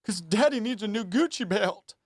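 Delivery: high, mocking preacher impression; sneering high preacher plea; televangelist voice. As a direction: ham up a greedy televangelist. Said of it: "He's like".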